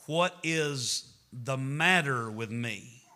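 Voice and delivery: each word spelled out slowly; slow, careful. Shown as a demonstration. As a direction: say it slowly, word by word, as the correct form. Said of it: "The correct way to say it is".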